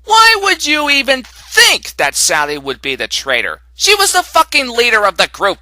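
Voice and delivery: loud, high, incredulous protest; indignant outburst. Acted. Reviewer defending Sally against the traitor theory, loud and indignant. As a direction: blurt it out in outraged disbelief.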